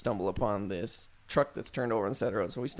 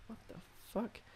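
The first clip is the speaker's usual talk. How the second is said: whispered disbelief; 10 semitones higher, 17 dB quieter than usual. Retold or imitated. Sees dark figure under streetlight.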